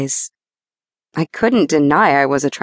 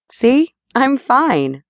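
slow, higher reassuring insistence; mild reassuring insistence; 7 semitones higher, much slower than usual. A narrator's reading. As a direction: assure him she's fine, slowly.

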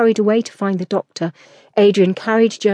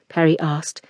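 plain reading voice

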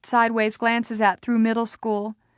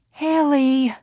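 tired, irritated; 4 semitones higher than usual. A narrator's reading. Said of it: "She sounded tired now, almost irritated".

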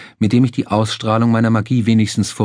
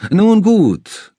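talk show host; 11 semitones higher, 5 dB louder than usual. Acted. Talk show host Larry voiced.

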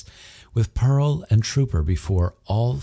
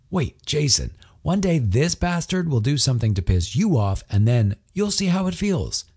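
higher, lightly voiced sulky husband; a mock warning to his friend; Connor grumbling; 8 semitones higher than usual. A narrator's reading. Husband warning his friend, mock sulky and higher.

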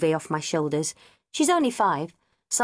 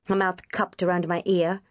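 plain reading voice

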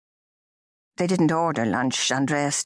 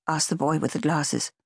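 plain reading voice

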